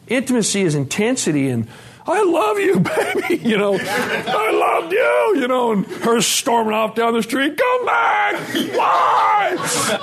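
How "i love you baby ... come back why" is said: mock lovesick pleading. Mocking his own intense romance, calling after his storming wife with a high laughing shout. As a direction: overdo a dramatic lover crying after her.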